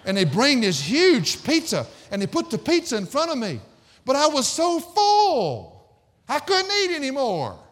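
loud, high, drawn-out lament; hammed-up despair at being too full; exasperated comic wail. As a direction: build to an anguished mock cry. Comic climax of overeating story.

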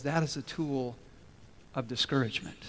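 soft, low delivery sinking at the end; hushed, weighty storytelling; grave reveal. Acted. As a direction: deliver the devil's punchline quietly. Devil's punchline, solemn reveal.

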